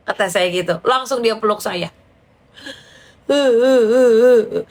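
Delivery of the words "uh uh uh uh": loud, hammed-up boo-hoo sobs; mock wailing sobs in a row; imitated sobbing. Friend hugs the speaker and breaks down, imitated sobbing.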